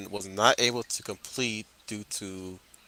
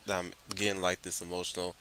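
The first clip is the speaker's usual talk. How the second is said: ordinary talk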